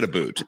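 "boot" for about, with a long oo vowel; "a boot", the Canadian about exaggerated into oo; imitated Canadian pronunciation. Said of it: "you have a Canadian accent".

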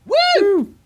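very high whoop; excited cheer. Real, not acted. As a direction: whoop like a fan. Cheering for Bethesda.

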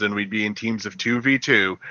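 ordinary delivery

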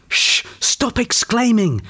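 high, drawn-out telling-off; urgent shushing. A narrator's reading. Peter shushes Vera lest they're given away, urgent shushing.

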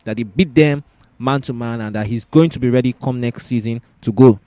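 normal talking voice

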